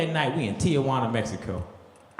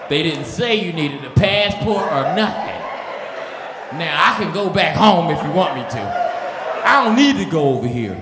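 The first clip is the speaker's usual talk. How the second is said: slow, laughing, pleading excuse; laughing plea; 3 semitones lower than usual. Retold or imitated. Explaining to the border official, slow laughing plea. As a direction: beg your way out, laughing.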